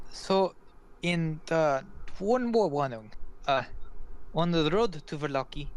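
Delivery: earnest foreign woman giving road advice; warning Vistani woman. Acted. Vistani woman warns travelers off the night road, accented and insistent.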